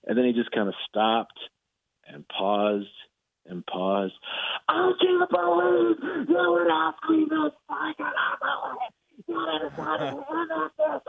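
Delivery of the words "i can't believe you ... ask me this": high, slow, furious disbelief; berserk coach. Retold or imitated. Writer retells the coach exploding at him in high furious disbelief. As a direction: imitate the coach losing it in outrage. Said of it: "just going just berserk on me".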